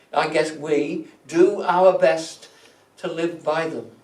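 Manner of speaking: ordinary delivery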